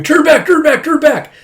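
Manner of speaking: very high, loud repeated scream; acted frantic screaming plea. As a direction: scream it over and over, desperately. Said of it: "keeps just screaming".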